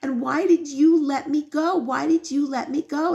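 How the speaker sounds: high, indignant protest to a father; imitating an outraged kid; retold child's yelling. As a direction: blame your father, pitch rising. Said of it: "yells at his father".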